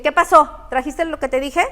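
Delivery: loud, demanding question; mother scolding son. Retold or imitated. Mother confronting her son as he walks in, loud. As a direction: call him out sharply. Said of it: "Y dice".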